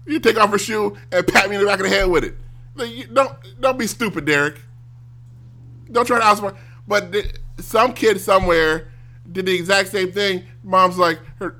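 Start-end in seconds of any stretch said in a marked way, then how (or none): 2.8-6.6 mock scolding mom. Mom scolding in retold story.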